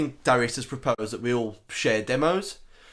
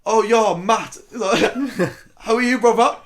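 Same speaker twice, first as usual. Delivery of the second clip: higher, louder hammed-up posh accent; posh boy voice; 7 semitones higher, 6 dB louder than usual. [name] ringing his mate Matt, exaggerated posh boy voice. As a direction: play a posh lad on the phone. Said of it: "put on a posh boy voice".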